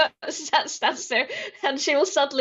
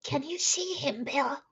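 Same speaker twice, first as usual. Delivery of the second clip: whisper; 6 dB quieter than usual. Acted. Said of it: "she will whisper in the barrel".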